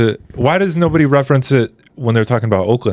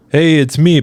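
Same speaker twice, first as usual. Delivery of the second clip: raised, upbeat actor impression; higher, lively impression voice; Pedro Pascal; 6 semitones higher, 4 dB louder than usual. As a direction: introduce yourself like a celebrity in a clip. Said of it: "I saw a clip recently of Pedro Pascal".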